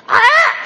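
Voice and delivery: high shrieked "Ah!"; frazzled mom scream. Retold or imitated. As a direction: scream like a mom at the end of her rope. Mom losing it, wordless.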